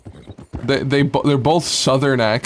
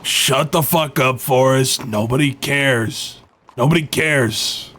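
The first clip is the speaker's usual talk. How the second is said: Wyatt voice. Pretend. Streamer does Wyatt on a viewer's request. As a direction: growl it like the grumpy Wyatt. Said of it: "Can you give us a little Wyatt?".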